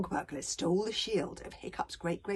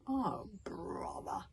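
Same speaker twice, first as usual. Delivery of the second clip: exasperated sigh; 9 dB quieter, much slower than usual. Acted. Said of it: "Hiccup raised his eyes".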